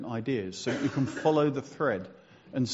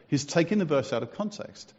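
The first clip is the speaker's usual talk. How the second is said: ordinary talk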